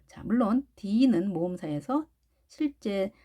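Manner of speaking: normal talking voice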